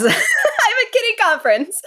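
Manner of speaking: giggling through the words; laughing. Pretend. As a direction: laugh while saying it. Laughing cat bit.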